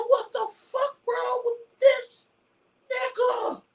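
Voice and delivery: high, slow squeal of disbelief; squeaky disbelief. Retold or imitated. Retelling his reaction to a clip, high-pitched mock disbelief. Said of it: "But I said".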